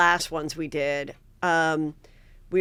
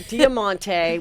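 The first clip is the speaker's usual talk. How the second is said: normal talking voice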